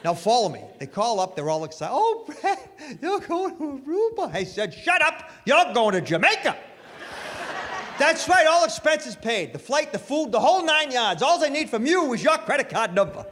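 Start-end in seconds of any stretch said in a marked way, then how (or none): 1.9-4.3 much higher, giddy sales pitch voice; giddy telemarketer. Retold or imitated. Telemarketer calling Brad, gushing sales pitch. 4.7-13.2 higher, louder hyped-up prize pitch; mock excited pitch. Brad to the telemarketer, hyped-up prize pitch. Brad turns the scam around.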